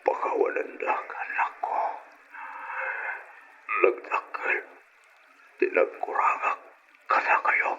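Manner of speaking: weak, fading whisper; last breaths, words barely voiced; dying whisper. Dying father forgiving his son, faint whisper.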